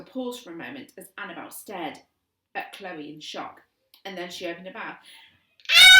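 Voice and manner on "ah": sharp yelp of pain; loud wail. A narrator's reading. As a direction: cry out, hurt. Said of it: "wailed Annabel".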